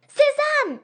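excited yell; triumphant shout. Acted. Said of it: "Cria Leslie".

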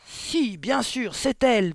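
high, loud, emphatic insistence; angry headmistress boom; thundering. A narrator's reading. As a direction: thunder each phrase as a verdict. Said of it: "tonna mademoiselle Le Gourdin".

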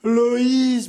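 feverish mother calling. A narrator's reading. Delirious mother calls her lost daughter, high and aching. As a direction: reach out for the lost child.

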